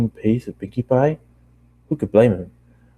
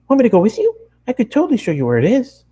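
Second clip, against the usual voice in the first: much higher, louder and fast eager pony; excited high Pinkie; 13 semitones higher, 4 dB louder, faster than usual. Acted. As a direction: bounce with eagerness to help. Pinkie voiced in read story.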